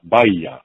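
Baiya rejoined as "bye-ya"; closer pronunciation Baiya. Shown as a demonstration. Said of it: "much more closer pronunciation than Beiya".

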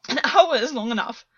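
shouted bitter answer. A narrator's reading. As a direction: hurl it out in despair. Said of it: "August shouted".

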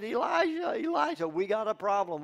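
high, loud frantic calling of the name; panicked calling. Acted. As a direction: shout for him in a panic. Panicked servant runs in.

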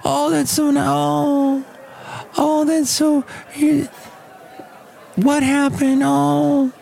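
very high, mock cooing voice; hammed-up falsetto sympathy; pitying onlookers. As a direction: imitate people gushing over a poor dog. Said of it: "They have to go".

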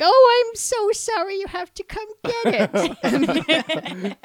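put-on high, sweet creepy old lady; creepy old woman voice. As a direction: coo like a spooky old woman. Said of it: "who did the whole creepy voice".

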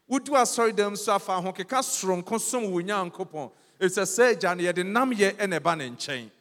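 loud, urgent exhortation; rally the church to come boldly; preaching at full force. Acted. Exhorting the congregation loudly.

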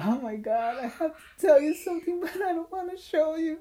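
very high mock sobbing; hammed-up tearful girl, squeaking upward; imitated crying. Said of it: "running to me crying again".

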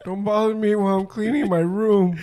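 high-pitched mock protest; mock indignant kid. Acting out a kid cleaning his room, high indignant voice. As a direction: protest like a put-upon kid.

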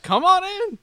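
high, loud mock welcome; mock cheerful welcome. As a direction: shout a cheery "come on in" like inviting the pizza man.

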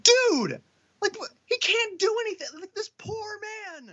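high, strained outburst that fades to almost nothing; pleading high, then trailing off quiet; exasperated defence. Retold or imitated. Reacting to a conspiracy video, high and exasperated. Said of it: "and I'm just like".

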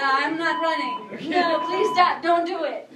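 loud, high frantic begging; panicked pleading. Retold or imitated. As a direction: beg them to stop, panicking. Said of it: "no, it's the Yang one".

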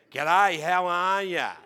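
loud, slow, broad Australian twang; Australian accent. Retold or imitated. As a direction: drag out a grating Australian greeting. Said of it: "that accent".

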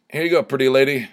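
exaggerated, not quite sincere charm; insincere compliment. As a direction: toss out a mock-smooth compliment. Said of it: "I said it really more sincerely than that".

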